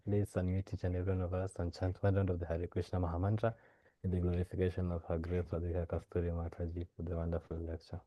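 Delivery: ordinary talk, nothing marked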